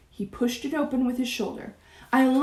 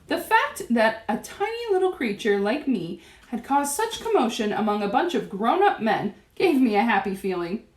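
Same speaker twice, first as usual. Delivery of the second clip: ordinary delivery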